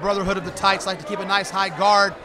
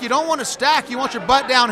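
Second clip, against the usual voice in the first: ordinary talk, nothing marked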